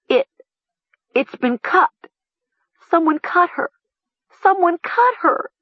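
halting, then climbing very high; stammer, building to a horrified cry; high, panicked, rising hysteria; stammering in horror. A narrator's reading. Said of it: "Mara stammered".

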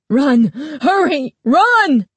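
very high, loud urgent scream; frantic screaming. Acted. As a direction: shriek at her to hurry. Shopkeeper screaming warning.

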